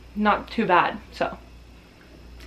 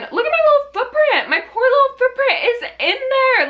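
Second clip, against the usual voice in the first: put-on much higher, pouty baby voice for the poor footprint; cutesy baby voice. Showing viewers her bloody footprint, high pouty baby voice. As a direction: coo over it like a hurt little thing.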